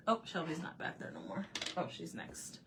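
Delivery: ordinary talk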